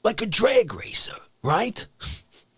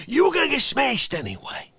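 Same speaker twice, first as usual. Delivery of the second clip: usual speaking voice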